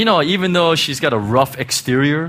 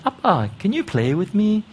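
imitating a little girl's Hong Kong intonation, pitched higher; 6 semitones higher than usual. Father imitating daughter asking him to play, Hong Kong intonation. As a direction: lilt the question with a Hong Kong tune, playfully. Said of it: "the Hong Kong intonation".

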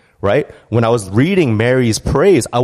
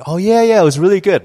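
high, bright, put-on agreement; higher and louder, overdone approval; fake enthusiasm; 9 semitones higher, 4 dB louder than usual. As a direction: sell a polite lie to a friend. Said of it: "you'd be like".

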